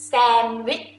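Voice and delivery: normal talking voice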